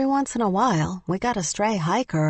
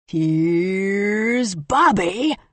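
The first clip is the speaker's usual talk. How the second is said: slow, stretched creepy "Here's Bobby!"; Jack Nicholson Shining impression; 2 semitones higher, 3 dB louder, much slower than usual. A narrator's reading. Bobby Ray greeting Maybelline as Jack Nicholson in The Shining. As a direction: draw it out like a crazed grin. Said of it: "like Jack Nicholson in The Shining".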